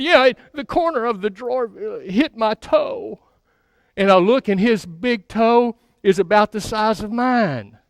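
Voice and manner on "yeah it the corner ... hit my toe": put-on little boy voice. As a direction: tell it timidly like a hurt child. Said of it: "And he said".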